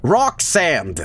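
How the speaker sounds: ordinary delivery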